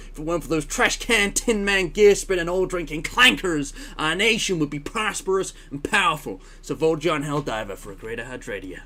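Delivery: indignant southern stump speech; hammed-up southern politician rallying voters; southern accent. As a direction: rattle off insults in a put-on southern accent. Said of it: "That's my southern accent, apparently".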